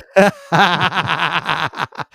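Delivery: a long put-on Cockney laugh. Shown as a demonstration. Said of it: "It's a Cockney laugh".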